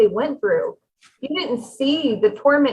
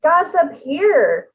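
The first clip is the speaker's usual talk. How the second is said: slow, raised; 5 semitones higher, 3 dB louder, much slower than usual. Shown as a demonstration. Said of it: "it shows the different vibrations".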